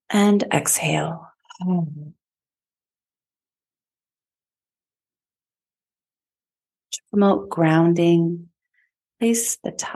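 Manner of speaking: even, measured meditation voice with long pauses; guided meditation. Acted. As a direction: guide listeners inward, phrase by unhurried phrase. Leads a chakra meditation.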